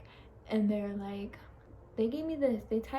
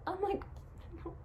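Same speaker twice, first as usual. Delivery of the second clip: ordinary delivery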